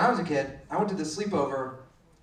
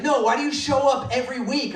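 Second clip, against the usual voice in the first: higher-pitched imitation of a mouthy child; defiant kid; 6 semitones higher than usual. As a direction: talk back like a stubborn five-year-old. Defiant kid challenges teacher.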